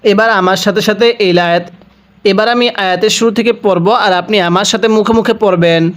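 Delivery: ordinary talk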